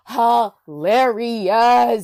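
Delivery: HILARIOUS stretched out long and loud; emphatic gushing. Real, not acted. Podcaster praising the funny Jared, "hilarious" hugely drawn out. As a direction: draw out every syllable of "hilarious".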